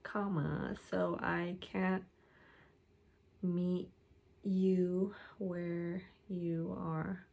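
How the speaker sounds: dictating while typing. Real, not acted. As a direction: stretch the words as you type. Typing the next line aloud.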